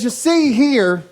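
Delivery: high, slow drawn-out mechanic voice; imitating Thug Life. Thug Life explains the kart to the racer, high mock voice. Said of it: "He says".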